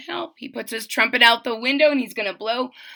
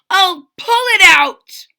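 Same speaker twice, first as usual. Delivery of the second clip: high loud yell; 10 semitones higher, 10 dB louder than usual. A narrator's reading. Trumpeter yelling to pull it out, loud yell. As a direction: shout the plan with force. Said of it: "he yelled".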